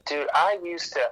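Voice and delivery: ordinary talk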